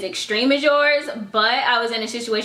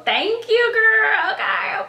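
high sassy thanks; 12 semitones higher, much slower than usual. Real, not acted. Answering the email's compliment.

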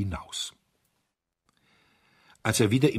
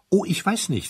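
much higher, light and breezy; airy high duke; 12 semitones higher, 4 dB louder than usual. Acted. The optimistic Duke of Chester lightly disagreeing, in a high airy voice. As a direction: chirp the mild objection.

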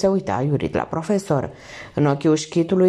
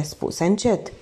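usual speaking voice

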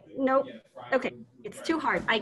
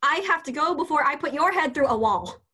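exasperated; 4 semitones higher, 5 dB louder than usual. Acted. Frustrated teacher storms off.

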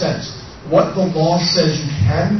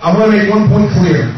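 loud; 7 dB louder than usual. Real, not acted. Rallying crowd, loud.

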